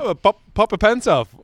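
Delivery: put-on high, loud botched British accent; hammed-up English accent; failed Australian accent, English instead. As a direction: aim at Australian and land in English. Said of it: "I keep doing English".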